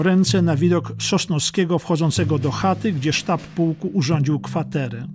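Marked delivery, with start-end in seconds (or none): none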